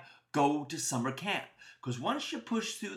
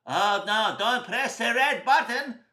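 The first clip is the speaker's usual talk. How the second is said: loud, higher, drawn-out warning; alarmed warning; 4 semitones higher, 6 dB louder, slower than usual. Acted. Friend warns a boy off the red button, alarmed. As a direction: plead slowly and loudly not to press it.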